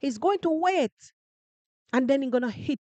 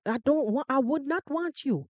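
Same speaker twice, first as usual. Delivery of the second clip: usual speaking voice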